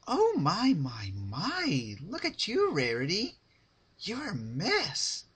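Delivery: very high, fussy fashionista voice, drawn-out swoops; mock the fashion pony with exaggerated dismay; Rarity impression. Pinkie mocking Rarity's look, in a hammed-up Rarity voice. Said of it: "imitating Rarity's voice".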